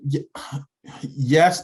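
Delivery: usual speaking voice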